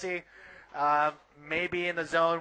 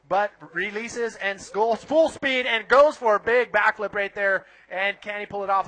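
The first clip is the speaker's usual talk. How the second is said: excited surf call, louder on the backflip; live play-by-play; 2 semitones higher, 5 dB louder than usual. Acted. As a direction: call the wave live as it happens. Live surf heat commentary.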